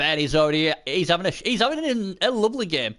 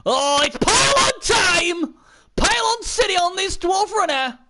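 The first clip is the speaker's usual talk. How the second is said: excited commentary shout; 11 semitones higher, 4 dB louder than usual. Real, not acted. Commentator greets the Pylon play, excited shout. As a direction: shout it like a big play just landed.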